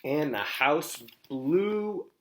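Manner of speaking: storyteller revealing the blast; dramatic reveal. A narrator's reading. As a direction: land the explosion slowly and loud.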